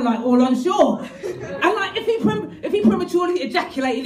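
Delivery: ordinary talk, nothing marked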